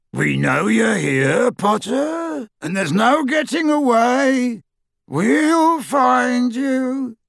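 high, drawn-out taunting call; menacing shout. A narrator's reading. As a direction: call out slowly to a hidden prey. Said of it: "a Death Eater shouted".

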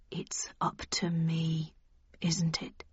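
low, hushed and slow. A narrator's reading. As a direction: murmur it slowly as it sinks in. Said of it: "he said in a slow voice".

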